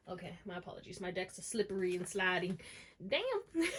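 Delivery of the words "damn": high laughing "Damn"; laughing curse. Real, not acted. Laughs at slipping deck.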